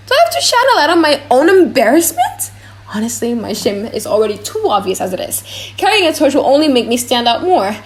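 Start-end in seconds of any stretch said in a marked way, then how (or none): 0.1-2.5 indignant. Acted. Jessica protests being torchbearer.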